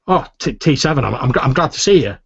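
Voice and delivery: high, loud, stammering gladness; acted heartfelt greeting, tripping over the words; sincere relief. Inigo runs out to greet the returning T seven, sincerely glad. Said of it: "he sounds quite sincere".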